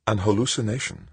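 ordinary delivery, nothing marked

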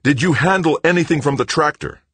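loud, high, frantic question; frantic demand. A narrator's reading. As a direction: demand an answer in panic. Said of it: "Frantic, Paul demanded".

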